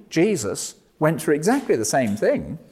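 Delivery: ordinary delivery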